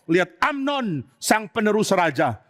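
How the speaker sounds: ordinary talk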